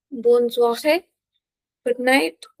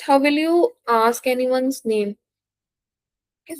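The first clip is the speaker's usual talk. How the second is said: ordinary talk, nothing marked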